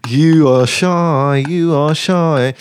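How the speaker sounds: slow, high, playful mocking tease; teasing. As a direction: draw it out like you're gently ribbing a shy fan. [name] teasing him after laughing.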